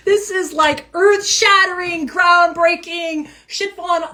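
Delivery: high, slow, emphatic build; dramatic emphasis. Real, not acted. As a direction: paint it as earth-shattering. Stressing how huge the card is.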